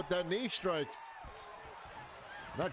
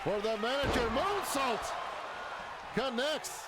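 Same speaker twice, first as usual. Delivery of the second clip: excited; 5 semitones higher than usual. Real, not acted. Big move in live commentary.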